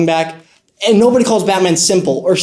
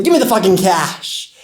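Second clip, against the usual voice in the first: robber's demand. Acted. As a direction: demand it like a street thug. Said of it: "I hear one of the good-for-nothings say".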